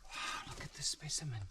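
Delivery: hushed, breathy whisper of awe; excited wildlife host whisper. Real, not acted. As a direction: whisper it so the snake stays calm. Whispering near the snake.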